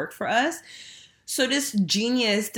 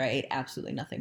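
ordinary delivery

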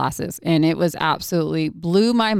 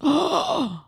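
shocked gasp; 11 semitones higher than usual. Retold or imitated. Shocked gasp in retold moment.

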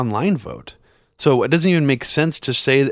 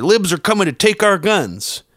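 higher-pitched mocking outcry; mock alarm; 8 semitones higher, 3 dB louder than usual. Guest mimics anti-gun-control mentality, mock alarm. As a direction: imitate someone alarmed about gun grabbers.

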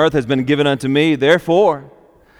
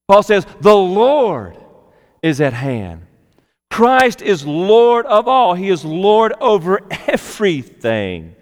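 preaching; 4 semitones higher than usual. Acted. Preaching Christ's lordship.

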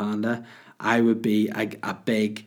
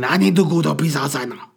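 put-on loud, higher Chinese-accented dog yell; mock Chinese accent, shouting; 5 semitones higher, 6 dB louder than usual. Dog begging owner to let him out, shouted mock Chinese accent. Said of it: "This is the way I talk".

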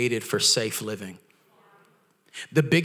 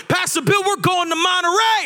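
much higher, loud eager announcement; excited announcement; 5 dB louder than usual. Retold or imitated. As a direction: burst out the news to the pastor.

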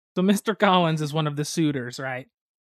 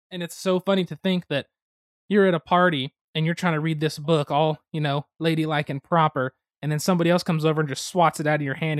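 ordinary delivery

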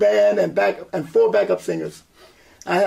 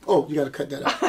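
laughing; 4 semitones higher, faster than usual. Real, not acted. Laughing quote about ponytail.

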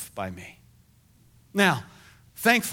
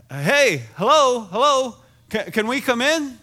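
loud, higher calls of a reporter at the door; calling out; 9 semitones higher, 8 dB louder than usual. Retold or imitated. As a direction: call in from outside the house. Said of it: "a lady outside going".

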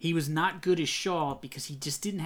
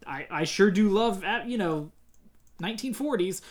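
mock time traveler; 5 semitones higher, 4 dB louder than usual. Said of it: "a time traveler try to go back".

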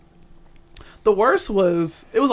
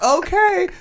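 high mock okay; 13 semitones higher than usual. Date answering, worried.

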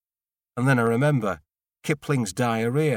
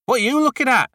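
high, loud sneering retort; hostile teen snap; 12 semitones higher, 7 dB louder, faster than usual. Acted. Teenage Benny snapping rudely at the nosy neighbour. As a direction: throw it back at him, defensive and rude.

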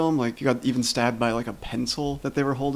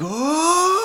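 high sudden yelp; mock startled cry; 5 dB louder than usual. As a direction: yelp like blood hits your face. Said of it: "he's like".

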